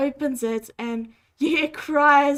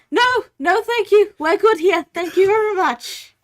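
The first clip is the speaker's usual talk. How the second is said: high, loud, hurried-sounding refusal; panicked staff cutting in; 7 semitones higher, 10 dB louder than usual. Acted. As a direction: cut her off loudly with polite protest. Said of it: "The, the staff immediately cover".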